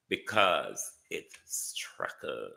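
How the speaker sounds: "Strachan" langsam und leiser in die Länge gezogen; drawn-out Strachan. Shown as a demonstration. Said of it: "when me call his name".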